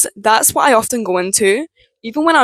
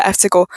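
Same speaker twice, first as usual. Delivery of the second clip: vocal warm-up sound; 4 semitones higher, much faster than usual. Shown as a demonstration. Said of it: "let my body know it's okay to make a sound".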